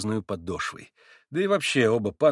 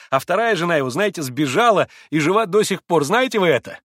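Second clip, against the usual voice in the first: much higher, louder laughing exclamations; exclaiming with laughter; 8 semitones higher, 7 dB louder than usual. A narrator's reading. Kalganov exclaims to Mitya, laughing excitedly. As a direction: gush with delight. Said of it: "восклицал Колганов".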